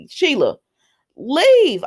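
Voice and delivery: retold exasperated command; mock stern order. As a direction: act out throwing someone out. Acted quarrel, ordering her out.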